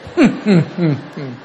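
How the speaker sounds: dry mocking laugh; sarcastic laugh. Husband mocked by his wife, sarcastic laugh. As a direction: laugh at him knowingly. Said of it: "Ya ves la risa sarcástica".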